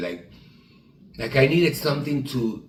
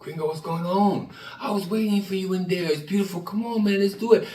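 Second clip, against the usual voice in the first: upbeat friend; 7 semitones higher than usual. Retold or imitated. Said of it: "Troy's upbeat coaxing".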